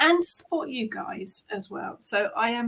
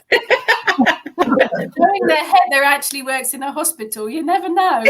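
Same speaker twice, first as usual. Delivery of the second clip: laughing; 6 semitones higher, 8 dB louder than usual. Real, not acted. Joking about a creepy chocolate eye.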